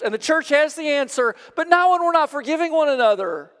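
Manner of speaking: loud, high fervent preaching; ringing pulpit voice, pitched high; preaching at full force. Acted. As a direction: build the sermon to a climax.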